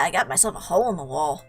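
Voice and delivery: ordinary delivery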